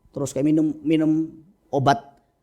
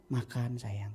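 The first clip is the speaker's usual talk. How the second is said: very soft, tender request; gentle words; 2 semitones lower, 12 dB quieter, slower than usual. Acted. Parent to a child who only obeys yelling, soft and gentle. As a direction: say it softly and lovingly, much quieter. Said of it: "nggak bisa lagi bahasa halus lagi".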